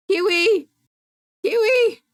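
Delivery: high, loud call of the name. Real, not acted. Streamer to the missing viewer, calling out. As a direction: cry out the name to summon them.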